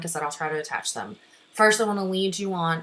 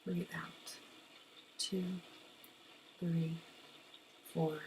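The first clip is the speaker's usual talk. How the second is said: guided breathing count; 15 dB quieter than usual. Shown as a demonstration. Teacher leads students in breathe in, breathe out, hushed meditation count. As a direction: count them through the breath, fading to near-whisper.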